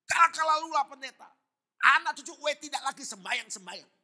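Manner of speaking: high, explosive angry shout; furious scolding. Acted. Enraged elder scolds the visiting pastor, furious high shout. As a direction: yell in an acted outburst of rage. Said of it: "Dia marah-marah".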